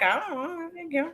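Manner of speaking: high, soft shrug of a voice; mock indifference. Daughter asked about the leash, mock indifference. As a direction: imitate an unbothered kid, high and quiet. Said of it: "She didn't care".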